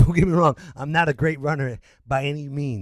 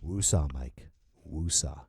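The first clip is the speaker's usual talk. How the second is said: low, soft calming mantra; self-soothing; 11 semitones lower, 6 dB quieter than usual. Real, not acted. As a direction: talk yourself down, low and breathed. Calming himself after rant.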